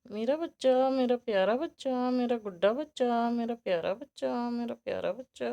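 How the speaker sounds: loud, high cooing over a pet. Real, not acted. Owner gushing over her favourite rabbit, loud high cooing. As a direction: gush over the bunny.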